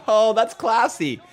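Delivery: high, slow, laughing approval; amused laughing. Real, not acted. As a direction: savour it slowly through a laugh. Amused by British donation voice.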